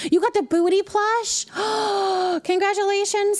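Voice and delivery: excited squeal. Real, not acted. Excited reaction to chat news.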